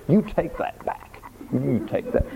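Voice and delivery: mock playground retort, nose to nose; a kid saying you take that back; childish taunt. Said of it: "the ultimate thing that you could say to somebody when you were totally frustrated".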